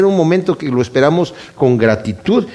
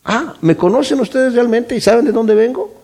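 higher, mocking disbelief; sarcastic challenge to the crowd; 7 semitones higher than usual. Shown as a demonstration. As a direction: throw the question back with sarcasm. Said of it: "lo está diciendo con sarcasmo".

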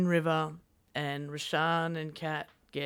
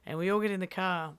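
ordinary talk

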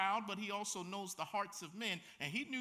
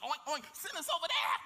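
pig-demon voice; 5 semitones higher than usual. Acted. Demons voiced mocking pigs.

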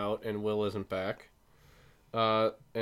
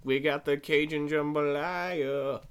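hammed-up Cajun accent like a restaurant ad; mock Cajun accent announcing the menu; weird Cajun accent; 4 semitones higher than usual. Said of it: "that was a weird accent".

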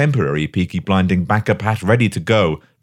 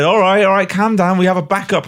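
lightly higher, placating tone; soothing gang mates; 9 semitones higher than usual. Retold or imitated. Gang members calming him, a lightly higher placating voice. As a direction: talk him down like a mate calming a fuss. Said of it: "And they said".

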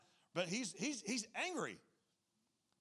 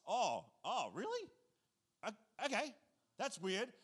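mock confused surprise; 3 semitones higher than usual. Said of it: "And then they're like".

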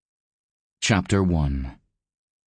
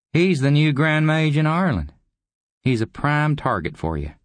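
high, animated character voice; higher, breezy schemer; goading visitor; 10 semitones higher, 3 dB louder than usual. A narrator's reading. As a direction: goad the old man in a higher voice.

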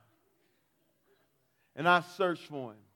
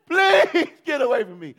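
frightened pleading; 8 semitones higher, 9 dB louder than usual. Retold or imitated. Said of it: "all I was doing is".

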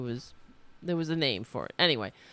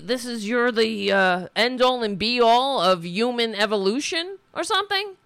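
loud, higher-pitched political rant; indignant rant; 6 semitones higher, 6 dB louder than usual. Real, not acted. Host railing at billionaires, in a real indignant rant. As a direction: rail at them, louder and higher.

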